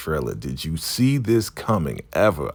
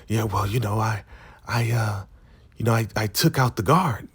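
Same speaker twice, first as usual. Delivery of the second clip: higher, halting put-on voice; imitated giddy confession; 6 semitones higher than usual. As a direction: stumble into a confession, pitched up. Giddy Zane confesses to River.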